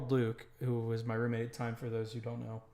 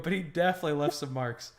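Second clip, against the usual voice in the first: ordinary delivery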